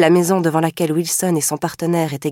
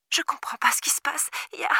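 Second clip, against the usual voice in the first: higher, breathless whisper; frightened whisper; 9 semitones higher, 8 dB quieter, faster than usual. A narrator's reading. Girl whispering in terror to the operator. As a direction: barely dare to speak, whispering in fear. Terrified girl on emergency call.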